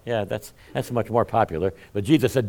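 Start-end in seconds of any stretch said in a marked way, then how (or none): none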